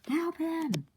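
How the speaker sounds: high squeaky panicked call; high cry for help. Acted. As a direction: shriek for help in a cartoon voice. Character cries for help.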